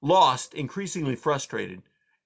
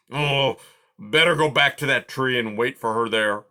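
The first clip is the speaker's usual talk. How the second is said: grumbling porcupine; 5 dB louder than usual. A narrator's reading. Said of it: "he decided".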